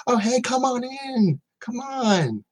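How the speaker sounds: put-on high welcoming young woman; girl's friendly welcome. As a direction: sing it up high like a cheery girl inviting you in. Voices the girl selling Keurig.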